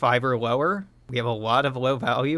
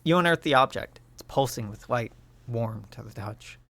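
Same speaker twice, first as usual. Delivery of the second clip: normal talking voice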